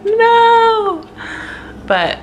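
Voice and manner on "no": much higher, louder drawn-out whining no; whiny refusal. Retold or imitated. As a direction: whine it like a kid who won't get up. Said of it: "And I'm like".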